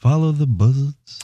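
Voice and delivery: put-on Bray Wyatt Southern accent. Joke announcer closing his call, Bray Wyatt accent. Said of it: "the Bray Wyatt accent".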